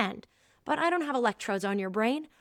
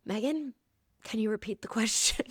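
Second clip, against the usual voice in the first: subdued, much softer retold voice; swallowed reaction; 6 dB quieter than usual. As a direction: imitate a boss swallowing hard, nearly whispering. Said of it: "He swallowed and was like".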